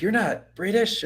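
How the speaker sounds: slow, high-pitched mock questioning; mock disbelief. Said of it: "They're like".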